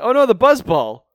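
high, loud panicked shout; alarmed cry. Retold or imitated. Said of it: "Wild Wing's like".

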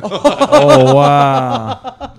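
high, loud laughing wow; surprised laugh. Real, not acted. Listener reacting to the punchline, high loud laughing surprise. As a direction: gasp it out in delight.

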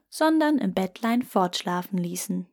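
usual speaking voice

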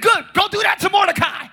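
loud high command; the king shouting a gleeful command; king's order. Retold or imitated. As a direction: bark the order at Haman.

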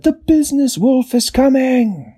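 alarmed cry. A narrator's reading. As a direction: raise the alarm in terror. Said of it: "And then there was a cry".

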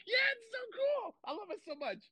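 very high, excited squeal; high-pitched hammed-up enthusiasm; giddy squeal. Retold excited answer, squealed.

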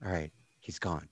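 very soft, low counting; barely audible. Acted. Barkeep heard from outside counting, barely audible. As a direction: talk low to yourself, as if overheard through a wall. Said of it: "you hear just barely from inside".

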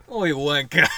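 loud hammed-up Australian heckle; broad comic Australian insult; mock Australian accent. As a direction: call out as an indignant Aussie. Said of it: "Australian man".

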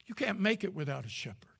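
sudden intimate whisper; soft whispered aside. Real, not acted. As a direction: drop to a whisper for emphasis. Sudden whisper after loud quote.

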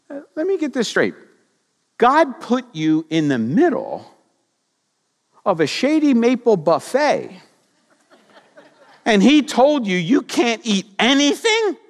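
high, quick, needling tempter; sly tempter voice. Acted. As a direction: twist God's words like a sly serpent. Satan voiced mocking God's rule.

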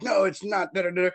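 high mocking retort; mocking the crowd. Mimicking rival fans denying the call. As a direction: parody their denial. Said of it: "And they're like".

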